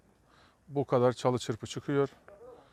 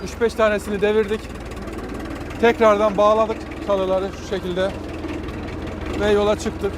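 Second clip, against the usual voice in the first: on a bumpy road; 5 semitones higher, 4 dB louder than usual. Real, not acted. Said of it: "Yollar şöyle bozuk, göstereyim size. Çukurlara giriyoruz".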